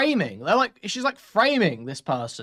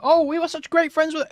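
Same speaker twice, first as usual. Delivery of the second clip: high, loud mocking gush; mocking fake friend; 11 semitones higher, 5 dB louder than usual. Mocking the people on Twitter who claimed to be her friend, high loud gush. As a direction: parody the fake grieving friends, pitched way up. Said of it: "They're like".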